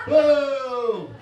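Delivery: loud, high heckle of disapproval; booing. Acted. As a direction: boo like a heckling passenger. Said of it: "boo the entire time".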